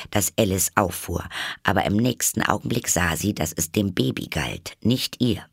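ordinary delivery, nothing marked

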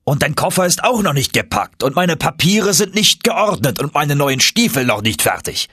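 hoch, hastig aufzählender Professor; aufgeregter Professor. A narrator's reading. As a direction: der Onkel schimpft über Unerledigtes. Uncle scolds, held figure. (aufgeregt vorwurfsvoller Onkel)

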